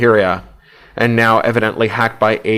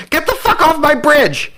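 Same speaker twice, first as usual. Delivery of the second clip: much higher, wild furious holler. A narrator's reading. Bonnie hollers at Hugh from her chair, wild and furious. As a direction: scream him off the bridge. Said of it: "she hollers".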